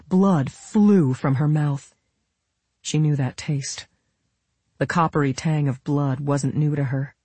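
ordinary delivery

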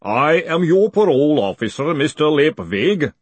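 loud, high booming announcement; booming golem. A narrator's reading. Golem introducing itself to its captive, booming.

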